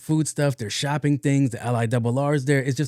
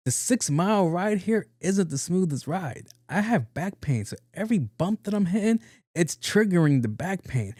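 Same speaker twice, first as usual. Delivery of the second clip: acted complaining; 7 semitones higher than usual. Patient complaining to the doctor about back pain, high grievance. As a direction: plead the case in a raised voice.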